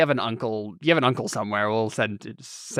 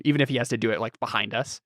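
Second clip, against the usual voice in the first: ordinary delivery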